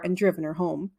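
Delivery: ordinary delivery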